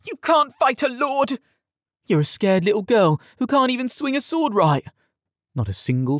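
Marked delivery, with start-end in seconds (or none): 0.0-1.4 very high, strained outcry; desperate protest. A narrator's reading. Yared protests while pinned down. 2.1-4.9 high, jeering put-down; scornful taunt. A narrator's reading. Tom taunts pinned Yared.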